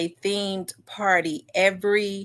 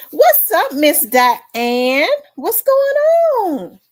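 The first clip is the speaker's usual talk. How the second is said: much higher and louder, calling out happily; excited greeting; 10 semitones higher, 9 dB louder, slower than usual. Real, not acted. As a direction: shout a joyful hello to a favourite viewer. Greets viewer joining stream.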